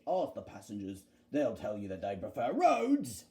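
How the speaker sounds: low, dismissive bus character; Bulgy. Acted. Bulgy brushing off Duck's challenge, low and dismissive. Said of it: "said Bulgy".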